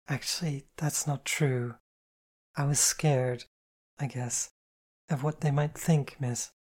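lightly voiced ashamed girl; slow, hesitant confession; ashamed young woman.

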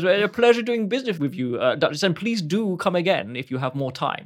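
a pleased shopkeeper seeing off a customer. Acted. Shopkeeper thanks the Duchess for her business, fast and high. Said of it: "And he says".

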